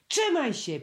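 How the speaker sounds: high little squeak of support. A narrator's reading. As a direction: squeak it out encouragingly. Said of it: "pisknął Prosiaczek".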